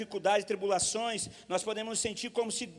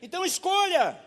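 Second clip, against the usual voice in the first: high, loud cry; shouted appeal; 8 semitones higher, 5 dB louder than usual. Real, not acted. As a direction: call them to choose. (apelo gritado)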